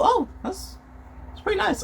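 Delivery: soft, high little murmur; understated reaction. Acted. Showing a real reaction to outfits, understated murmur. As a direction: react quietly, as if musing to yourself. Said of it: "When I sit back and react to things".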